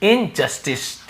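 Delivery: Injustice drawn out slowly, syllable by syllable; teacher slowly repeating injustice; slow model word. Shown as a demonstration. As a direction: spell injustice out slowly for students. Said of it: "बोलते हैं injustice".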